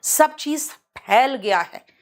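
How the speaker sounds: "फैल" begun with aspirated p; slow sentence, popped p in "फैल"; Hindi pha. Shown as a demonstration. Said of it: "ध्यान से सुनना बेटा".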